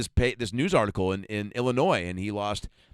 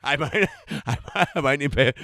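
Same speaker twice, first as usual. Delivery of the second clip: laughing; 2 semitones higher than usual. Real, not acted. Laughing through line.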